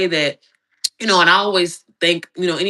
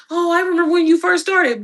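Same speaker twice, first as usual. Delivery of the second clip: mock viewer voice; 9 semitones higher than usual. Mimicking a long-time viewer.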